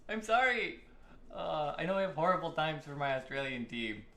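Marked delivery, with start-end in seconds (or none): none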